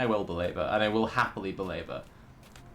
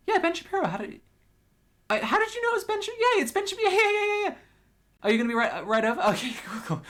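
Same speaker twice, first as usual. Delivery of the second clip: high, hurried hammed-up phone call; mock emergency caller; much faster than usual. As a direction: squeal it in pretend amazement, words tumbling out. Acted phone call to super police.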